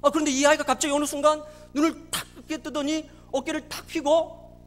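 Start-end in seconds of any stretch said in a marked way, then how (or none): none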